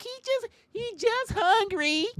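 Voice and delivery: very high, slow, sugary voice; mock naive bear lover. Comic mocks the neighbor defending the bear, very high. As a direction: squeak it out like a clueless pet lover.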